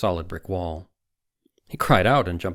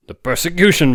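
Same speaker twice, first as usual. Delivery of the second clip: much higher and louder, eager; excited outburst; 10 semitones higher, 11 dB louder than usual. Acted. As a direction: blurt out the revelation. Webber bursts out excitedly.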